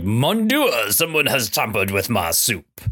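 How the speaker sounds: hammy French chef voice. Acted. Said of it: "leaned into the voice of the French chef".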